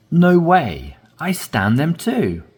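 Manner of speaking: No way said high and loud in surprise; surprised emphasis. Shown as a demonstration. Teacher demonstrating surprise for learners, high and loud. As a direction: burst out No way, amazed. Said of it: "So you're emphasizing".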